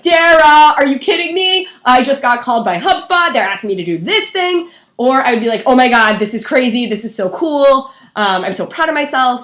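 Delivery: loud, high burst of excited news; genuinely excited friend. Acted. Telling a friend about a big opportunity, excited. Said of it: "things that you would say to a friend".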